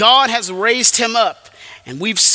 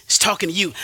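usual speaking voice

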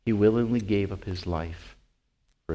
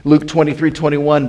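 ordinary delivery

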